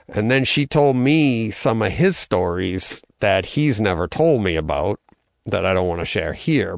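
usual speaking voice

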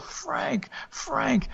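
high, soft ghostly wail of a name; dreadful wail. Acted. Dead wife calls her husband from outside, high ghostly wail. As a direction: wail his name like a lost spirit calling. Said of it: "her dreadful wail of".

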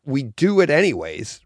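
usual speaking voice

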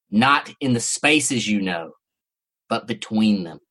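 low, slow ominous monster murmur; ominous creature voice. Acted. Lightly voiced creature giving its last warning to the narrator, low and slow. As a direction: let the final prophecy sink down and slow. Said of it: "It spoke in guttural, slurping voice".

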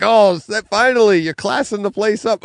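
high, loud quote of relieved fans; mock delighted relief. Fans welcoming the British guest, mock delighted relief. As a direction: exclaim it up high, as excited onlookers. Retelling listeners' reaction to guest.

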